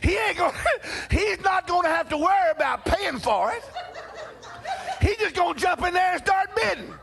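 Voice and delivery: can barely get it out for laughing. Real, not acted. Preacher laughing at his brother's auction bid.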